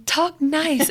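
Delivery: put-on high, spiteful kid's voice; hateful little girl. Little girl snaps at her brothers in a high, hateful voice. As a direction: spit it out like a cross little girl. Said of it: "in the most, like, hateful voice".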